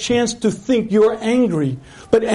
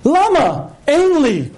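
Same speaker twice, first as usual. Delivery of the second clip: exasperated pleading; 8 semitones higher than usual. Retold or imitated. Exasperated repeated asking and reply.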